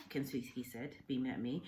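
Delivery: usual speaking voice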